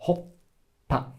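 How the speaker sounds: "hoppa" with a silent break before the p releases; long P. Shown as a demonstration. Teacher doing the same with p, broken "hoppa". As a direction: hold the stop inside "hoppa". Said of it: "We do the same".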